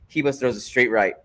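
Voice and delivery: ordinary talk, nothing marked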